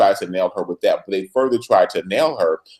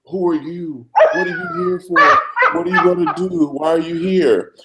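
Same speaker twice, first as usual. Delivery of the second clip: lightly voiced nervous prisoner, higher; imitating the prisoner; 7 semitones higher than usual. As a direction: play the confused inmate, a bit higher. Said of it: "he's like".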